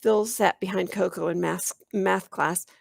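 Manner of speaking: ordinary delivery, nothing marked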